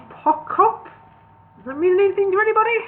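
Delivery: high, fast puzzled query; puzzled wizard. A narrator's reading. Gandalf asking the dwarves about the letters, fast and high. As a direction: toss the guess out quickly.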